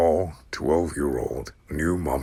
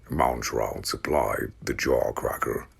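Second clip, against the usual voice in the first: usual speaking voice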